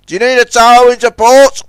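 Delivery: high, loud shouted offer; Zoran calling out. A narrator's reading. Zoran offers strangers a tow, calling out. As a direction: project it across the bay. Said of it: "he called".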